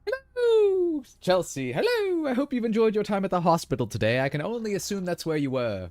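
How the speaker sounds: theatrical put-on tailor. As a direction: greet grandly in a silly tailor voice, swooping high then settling. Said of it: "That's what all tailors sound like".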